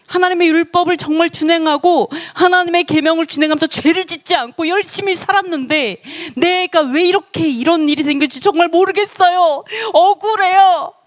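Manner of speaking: high wailing plea, acted out; tearful protest. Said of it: "아, 막 울었어요". (울먹이듯 높게 억울함을 호소하는 흉내)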